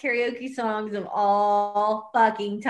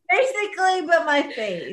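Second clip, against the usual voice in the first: laughing; 6 semitones higher, 3 dB louder than usual. Real, not acted. Drunk giggling fit.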